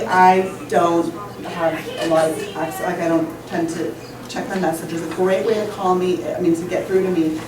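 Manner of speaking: usual speaking voice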